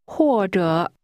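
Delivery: language-drill 或者, each syllable's tone drawn out; falling, then falling-rising tone. Shown as a demonstration. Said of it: "pronounced with a falling tone".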